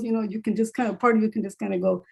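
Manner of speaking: normal talking voice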